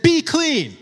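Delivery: high, loud command; healing command. Retold or imitated. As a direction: order it with full authority. Jesus' healing command acted out.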